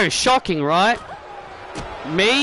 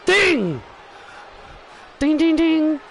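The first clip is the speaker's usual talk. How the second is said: high, ringing "ding"; imitated ring bell; 5 semitones higher, slower than usual. Commentator opens the match, imitated bell. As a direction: mimic the bell with your voice.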